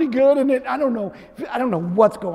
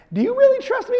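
a much higher, lightly voiced question; God's challenge; 14 semitones higher, faster than usual. Preacher voices God asking if he trusts him, lightly higher. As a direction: lightly voice God calling out his doubt. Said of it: "That it's like".

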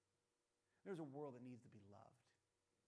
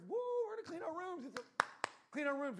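much higher, louder whooping chant; mock rally cheer; 12 semitones higher, 12 dB louder than usual. [name] rallying cleaners, hammed-up cheer. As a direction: hype the crowd.

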